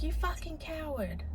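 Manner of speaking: understated, high and soft imitation of an irritated woman; irritated mum imitated. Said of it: "this lady was irritated".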